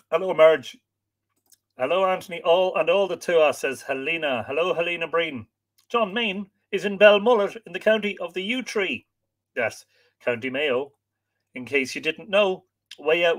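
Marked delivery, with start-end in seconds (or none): none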